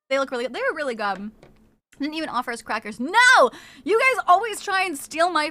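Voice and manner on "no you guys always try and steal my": loud, high playful no; loud protest. Real, not acted. Streamer protesting to friends, loud playful no. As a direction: cut them off.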